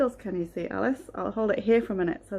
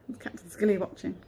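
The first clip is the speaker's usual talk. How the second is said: ordinary delivery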